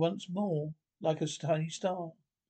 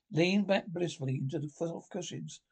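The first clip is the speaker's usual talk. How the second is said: ordinary delivery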